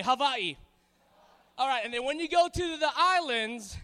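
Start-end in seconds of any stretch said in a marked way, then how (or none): 0.0-0.6 Hawaii with a V in place of W; Hawaiian pronunciation. Shown as a demonstration. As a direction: model Hawaii for repeating, W as V. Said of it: "it's a V pronunciation".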